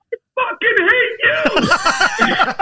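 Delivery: very high, loud, drawn-out joyous cry; gleeful hatred. Retold or imitated. Old fan lighting up at meeting the soap villain, gleeful high shout. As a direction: imitate a fan beaming with delighted loathing. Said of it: "the joy with his eyes and his smile".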